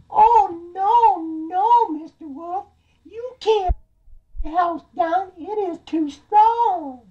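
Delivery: very high falsetto piglet; defiant little piggy. Acted. Piggy refusing the wolf at his door, high and defiant. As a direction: squeak it out, drawing out the last words.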